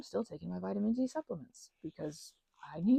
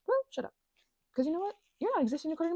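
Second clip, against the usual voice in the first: retort; 8 semitones higher, much faster than usual. Real, not acted. Pitch jump retort.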